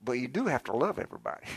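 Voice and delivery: ordinary delivery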